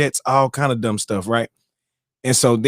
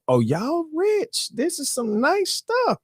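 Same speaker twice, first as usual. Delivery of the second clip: higher, slow, awed "y'all rich"; mock amazement; 5 dB quieter, much slower than usual. Host retells gawking at rich callers, high slow awe. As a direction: marvel at the wealth, drawn out slow.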